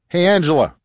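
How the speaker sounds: high, loud hail across a room; calling out. A narrator's reading. Organizer on stage hailing a woman below, calling out. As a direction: call her name from a distance.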